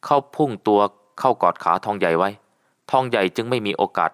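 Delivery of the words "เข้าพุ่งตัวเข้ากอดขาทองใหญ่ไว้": plain reading voice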